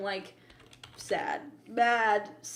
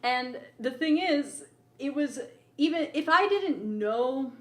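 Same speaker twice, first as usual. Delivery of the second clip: ordinary talk, nothing marked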